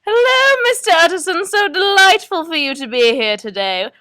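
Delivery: gushing psychic, high and loud at first; Madame Dubois. Acted. Psychic welcomes her first caller, theatrical gushing. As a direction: greet grandly, gliding down from high. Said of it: "hosted by Madame Dubois".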